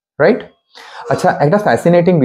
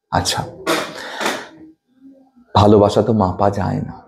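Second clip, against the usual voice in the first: ordinary delivery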